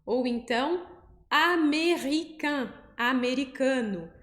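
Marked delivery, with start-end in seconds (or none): 1.3-2.7 américain said slowly with a nasal ending; French américain. Shown as a demonstration. Teacher modeling américain with the an sound. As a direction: stretch the nasal end of américain. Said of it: "A e N com esse som de an".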